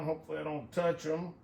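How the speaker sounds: ordinary talk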